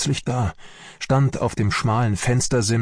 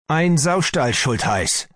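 outraged cry, pitched up; agitated shout; 7 semitones higher, slower than usual. A narrator's reading. Army doctor rages to Schultheiß about the pigsty, agitated shout. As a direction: burst out in indignation. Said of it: "schrie er aufgebracht".

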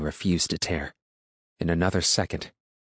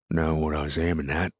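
growled. A narrator's reading. Farmer explains himself to the woman, growled. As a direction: grumble it in a rough rural growl. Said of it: "the man growled".

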